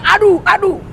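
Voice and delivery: high mock aduh aduh; imitating a complaining moan; imitated groan. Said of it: "Kau bilang".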